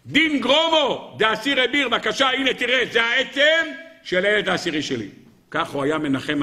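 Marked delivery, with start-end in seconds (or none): 0.1-5.1 high, forceful cry easing towards the end; acted bereaved father's impassioned declaration; anguished proclamation. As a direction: hold up the proof and cry it out. Bereaved father shows son's bone.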